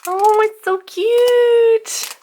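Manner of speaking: slow, high, loud gushing; excited squeal. Real, not acted. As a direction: gush over something adorable. Unboxing the gift.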